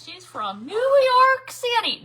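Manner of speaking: put-on high, loud and slow, like an old ad; mock commercial announcement. Host to viewers, quoting a salsa ad in a mock announcer voice. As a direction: ham up New York City the way the commercial did. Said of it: "remember that commercial".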